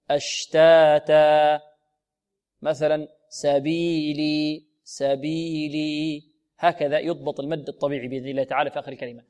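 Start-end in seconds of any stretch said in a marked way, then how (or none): 0.1-6.2 أشتاتا slow, each alif held two beats; measured long vowels. Shown as a demonstration. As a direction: stretch أشتاتا evenly. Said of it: "قولوا". (أشتاتا بمد طبيعي مضبوط)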